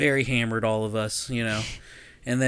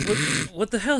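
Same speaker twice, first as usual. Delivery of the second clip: higher, stammered question; confused alarm; 7 semitones higher than usual. Retold or imitated. Sleepy passenger answering the panicked driver, confused alarm. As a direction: ask it pitched up, startled.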